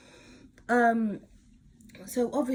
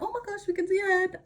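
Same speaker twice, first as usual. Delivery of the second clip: midwife's excitement; 11 semitones higher, faster than usual. Retold or imitated. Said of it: "and then they're like".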